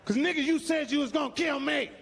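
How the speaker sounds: high, indignant comeback; wife answering husband, indignant; wife's retort. Retold or imitated. Wife's reply acted.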